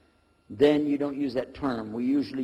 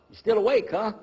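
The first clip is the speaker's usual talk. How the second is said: normal talking voice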